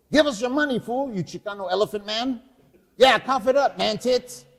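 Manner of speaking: loud mocking punks; taunting street kids. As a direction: jeer like cocky young thugs. Kids threaten the fat man.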